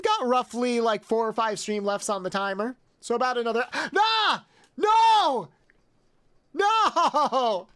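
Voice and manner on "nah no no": high, loud shocked cries of no; real shock at a surprise gift. Real, not acted. Hype train surprise from chat.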